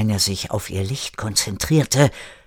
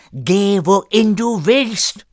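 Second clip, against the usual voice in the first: put-on high, loud, slow French-accented command; exasperated dismissal; 10 semitones higher, 6 dB louder, slower than usual. René rolling his eyes at Erik, exasperated French accent. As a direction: send him off in loud exasperation.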